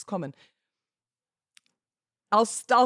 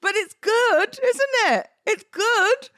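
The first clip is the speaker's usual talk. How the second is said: much higher and louder, delighted squeal; gushing; 8 dB louder, slower than usual. Real, not acted. Host gushing to listeners about the reading, high delighted squeal.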